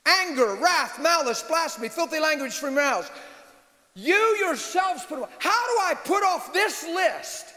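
high, emphatic pulpit delivery; forceful preaching. Real, not acted. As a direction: preach it hard, stretching the key phrases.

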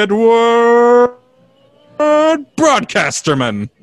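loud, high, hugely stretched name; hacky radio voice. Retold or imitated. Host announcing a made-up presenter, over-the-top radio voice. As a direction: build the name like a hammed-up announcer. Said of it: "the hacky radio voice".

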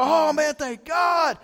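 loud, high relieved cry; hammed-up shout of relief; mock relief. Pastor imitating relieved churchgoers, mock loud relief. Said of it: "we go".

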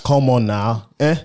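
African accent with "eh?" tagged on. Shown as a demonstration. Host teaching the little ending, African accent. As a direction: hit "Come on now" in an African accent, then add "eh?". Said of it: "a little at the end".

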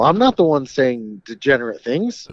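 ordinary delivery

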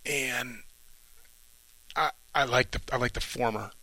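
normal talking voice

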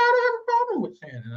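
high defensive mock voice; high mock quote. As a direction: squeak out the denial. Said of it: "She's like".

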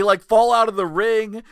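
ordinary talk

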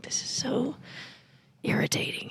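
whisper. Retold or imitated. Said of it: "I'm like".

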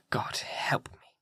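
hushed, despairing whisper; whispered prayer. A narrator's reading. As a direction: barely breathe the plea to God. Captain's desperate prayer alone.

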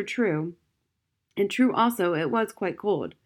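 ordinary delivery

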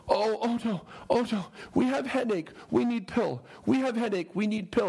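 pleading villagers. Retold or imitated. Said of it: "then go".

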